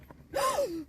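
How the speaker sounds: high sharp mock gasp. Retelling his reaction on the phone, a sharp gasp. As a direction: gasp in shock. Host reacts to campsite news.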